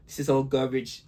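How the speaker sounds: low mock British villain voice; studio accent. Shown as a demonstration. Host demos his British bad-guy studio accent. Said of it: "like, my studio accent".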